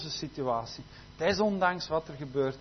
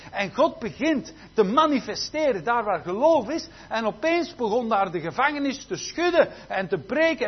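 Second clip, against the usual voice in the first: raised, driving preacher's delivery; fervent preaching; 6 semitones higher, 7 dB louder than usual. Real, not acted. Preacher telling how the prison shook, loud fervour. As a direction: build the miracle with loud, punched key words.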